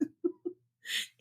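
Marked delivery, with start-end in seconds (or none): 0.0-0.0 laughing. Real, not acted. Laughing at dog joke.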